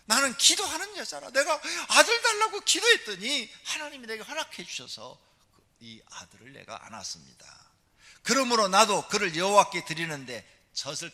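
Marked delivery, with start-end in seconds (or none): 0.1-5.2 much higher, loud proclaiming; fervent preaching. Acted. Preacher proclaiming Hannah's answered prayer, loud and high. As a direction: cry it out in triumph.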